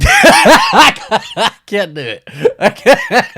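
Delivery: high squealing laughter through the words; giggling so hard the intro falls apart; laughing. Real, not acted. Host cracking up trying to read the intro, laughing.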